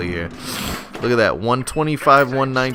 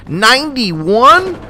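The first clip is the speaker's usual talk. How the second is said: high, slow, loud, drawn-out ninety-one; long stunned ninety-one; incredulous; 12 semitones higher, 6 dB louder, much slower than usual. Real, not acted. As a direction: stretch it out in sheer disbelief. Shocked at score.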